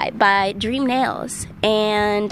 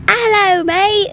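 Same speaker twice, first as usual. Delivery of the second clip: loud, high mock British greeting; hammed-up British accent, called out high and loud; 9 semitones higher, 5 dB louder than usual. Radio host introducing British punk, mock British accent. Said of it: "say it in the accent".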